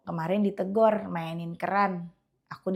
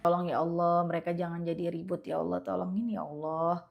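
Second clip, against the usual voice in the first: softer pleading prayer to God; quiet prayer; 5 semitones lower, 7 dB quieter than usual. Retold or imitated. Mother praying for her noisy children, softly. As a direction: ask God softly for calm kids. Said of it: "Ngomong aja dulu sama Allah".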